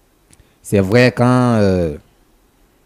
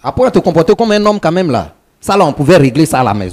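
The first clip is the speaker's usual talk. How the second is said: louder, raised-pitch scolding; angry rebuke; 8 semitones higher, 5 dB louder than usual. Real, not acted. Man telling the other to settle things at home, angry rebuke. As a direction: put them in their place, heated and high.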